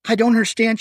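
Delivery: high, frightened panting; panting fear. Acted. Patricia gasping to her captor, panting fear. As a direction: pant it out, scared. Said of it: "she panted".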